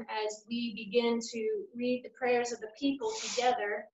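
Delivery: ordinary talk, nothing marked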